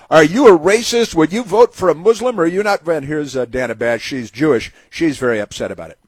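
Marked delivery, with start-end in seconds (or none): 0.1-2.9 higher, louder caricature of a loaded poll question; imitated pollster, pitched up; mock pollster question. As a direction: put the question in a pushy, raised voice. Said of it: "and they said".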